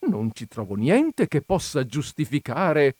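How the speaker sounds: plain reading voice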